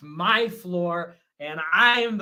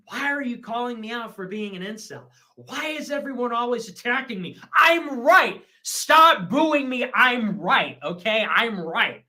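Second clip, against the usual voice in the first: building from protest to loud shouting; protest, then yell it over the booing; indignant outburst; 4 semitones higher, 8 dB louder than usual. Acted. Streamer defending himself to critics, indignant shout.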